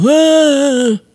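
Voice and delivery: put-on sharp high baby wail; baby cry imitation. Mom imitating her baby's wail from behind the door. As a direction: yell out like a fussing baby. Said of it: "He'd go".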